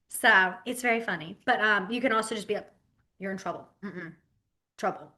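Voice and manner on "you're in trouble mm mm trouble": soft, low stern warning; quietly mean it; a hint of a stern teacher; serious warning. Shown as a demonstration. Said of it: "That can be a serious one".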